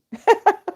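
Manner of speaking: a quick, high giggle; high burst of laughter. Real, not acted. Teacher laughing after teasing herself in the live chat. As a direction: laugh at your own joke. Laughs at her own joke.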